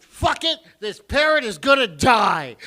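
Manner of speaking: loud, exasperated vow; fed-up magician. Acted. Magician who has had it with the parrot, angry outburst. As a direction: snap loudly, at the end of your rope. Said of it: "the fucking magician has had it".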